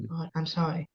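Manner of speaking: small, soft tearful apology; close to tears. Real, not acted. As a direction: apologise quietly, holding back crying. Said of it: "usually I cry".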